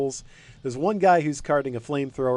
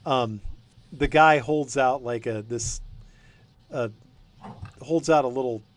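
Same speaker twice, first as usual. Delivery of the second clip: usual speaking voice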